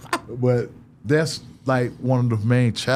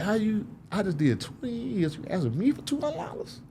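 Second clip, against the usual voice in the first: high-pitched, softer disbelief; incredulous; 10 semitones higher, 6 dB quieter than usual. Retold or imitated. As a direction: squeak it out in mock disbelief. Acting out incredulity at money request.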